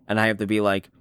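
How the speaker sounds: ordinary delivery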